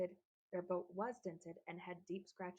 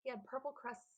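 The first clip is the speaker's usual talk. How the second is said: ordinary delivery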